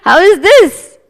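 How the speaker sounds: loud, high exclamation; hammed-up emphatic question; exclaimed question. Preacher exclaiming to audience.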